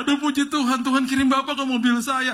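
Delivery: overwhelmed driver gushing and half-sobbing; hammed-up crying driver. Driver weeping to the preacher he just recognized, mock-sobbing. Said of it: "Dia nangis tiba-tiba".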